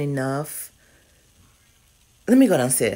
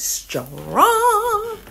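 high drawn-out; 3 dB louder than usual. Real, not acted.

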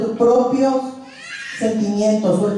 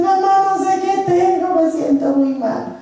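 much higher, softening into a plea; upset daughter; 8 semitones higher than usual. Retold or imitated. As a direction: voice the daughter coming to apologize. Daughter hugs mother, upset. (hija arrepentida, voz aguda)

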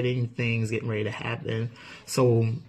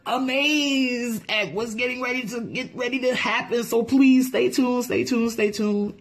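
excited; 8 semitones higher than usual. Real, not acted. Hyped outro promo.

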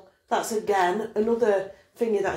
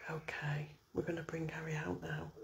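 hushed whispered aside; 5 semitones lower, 18 dB quieter than usual. Real, not acted. Quietly telling them to bring him out, whispering. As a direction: whisper it very softly. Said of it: "I've been really whispering".

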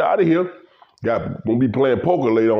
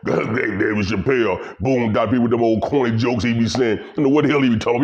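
ordinary talk, nothing marked

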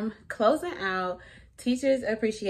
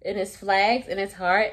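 ordinary talk, nothing marked

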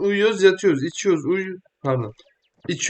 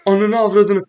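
loud, higher cursing outburst; exasperated swear; 6 semitones higher, 7 dB louder than usual. Real, not acted. Gamer cursing at the screen when the car hits. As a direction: blurt the curse out loud.

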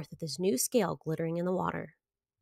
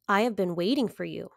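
ordinary delivery, nothing marked

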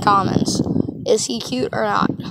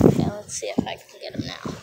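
hushed whisper while sneaking up; 5 semitones lower, 12 dB quieter than usual. Real, not acted. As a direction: whisper it so the fish stays put. Sneaking up on fish.

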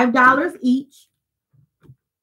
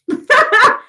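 loud, high laugh; high burst of laughter; 13 semitones higher, 4 dB louder than usual. Real, not acted. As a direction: let a sudden cackle out. Laughing at her own joke.

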